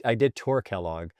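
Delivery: ordinary talk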